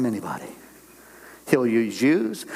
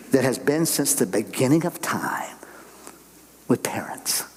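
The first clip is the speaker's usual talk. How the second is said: slow, hushed aside; whispered. Real, not acted. Preacher to congregation, slow whisper. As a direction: lean in and whisper it.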